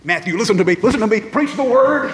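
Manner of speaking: high, loud urgent plea; wild-eyed insistent mentor; urgent charge. Retold or imitated. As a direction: grab him and drive it home. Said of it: "Almost a wild-eyed look in his eye".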